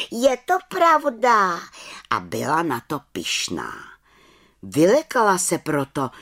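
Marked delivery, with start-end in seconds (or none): 0.1-1.6 high, slow proud statement falling at the end; proud affirmation. A narrator's reading. Little witch proudly confirming to the head witch. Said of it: "volala malá čarodějnice".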